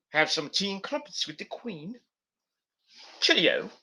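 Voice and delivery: a light put-on British accent, teasing; lightly mocking British accent. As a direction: tease the Brits with a hint of British accent. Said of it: "I have this weird accent".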